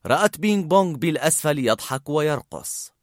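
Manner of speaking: ordinary delivery, nothing marked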